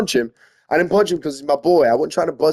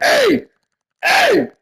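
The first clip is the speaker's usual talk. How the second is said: sharp, much higher yells of hey; frantic shout; 4 dB louder than usual. Retold or imitated. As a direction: yell it to jolt someone awake. Trying to wake unconscious friend.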